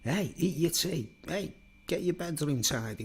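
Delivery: high-pitched mock mum voice; nagging mum. Mum at home telling the kids off, imitated nagging. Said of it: "She'd be talking to us going like".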